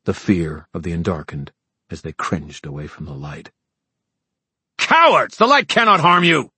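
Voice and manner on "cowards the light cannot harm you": high furious battle shout. Acted. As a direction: bellow it at the cowards. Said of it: "Sebastian shouted".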